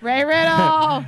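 slow, loud hype call; drawn-out announcer shout. Pretend. Hyping up co-host's name.

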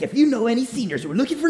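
mock the hyped-up host read. Mocking the TV host's senior promo, hyped announcer. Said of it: "high energy".

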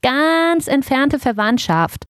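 high, drawn-out brush-off; airy little ermine dismissing the idea; dismissive. A narrator's reading. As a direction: wave it away with slow, lofty emphasis. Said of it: "winkte Hailey ab".

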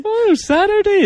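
high-pitched teasing mimicry; mocking echo. Everyone got on board teasing him, high mocking echo. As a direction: send the word up in a high voice. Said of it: "And I was like".

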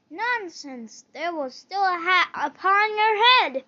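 loud angry retort; angry king. A narrator's reading. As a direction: snap back furiously.

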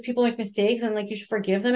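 ordinary delivery

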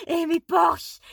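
high, loud scandalised shout. Real, not acted. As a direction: yell the name in shock. Outraged at the character.

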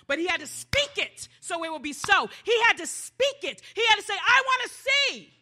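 high, loud, emphatic cry; impassioned preaching. Real, not acted. Preacher insisting the blind man speak it, high and loud. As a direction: preach it at full force, pitch high.

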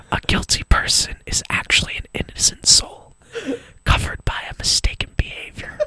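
breathy whisper close to the mic; giggly prank whisper. Acted. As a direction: whisper it like a mock guru. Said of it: "just whisper into the mic".

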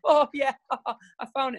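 a hint of a breezy kid laughing it off; cheery child. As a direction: lightly imitate a daughter who has suddenly cheered up. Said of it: "She'll go".

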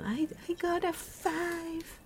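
very high, slow mock wail; mocking complainers. Imitating people upset over a grade, high mocking whine. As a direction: squeal it slowly, making fun of them. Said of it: "Those are the people".

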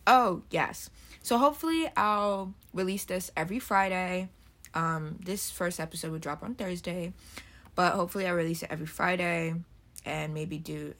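ordinary talk, nothing marked

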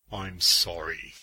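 low, slow apology; Green Arrow. Acted. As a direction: drop low and apologise slowly. Green Arrow apologises, head bowed.

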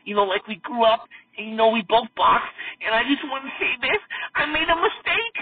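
high-pitched mock-emotional confession; hammed-up emotional plea, rising in pitch; imitated emotional apology. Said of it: "he's getting so emotional".

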